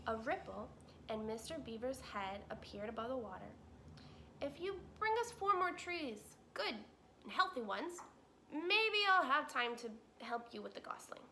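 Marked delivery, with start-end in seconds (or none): 4.4-11.2 higher, bargaining beaver; a gruff beaver striking a deal. Acted. Mister Beaver voiced.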